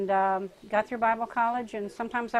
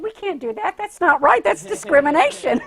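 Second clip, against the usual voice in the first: louder, higher, laughing indignation; indignant protest; 8 semitones higher, 11 dB louder than usual. Retold or imitated. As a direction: protest the unfairness loudly. Friend protesting discrimination.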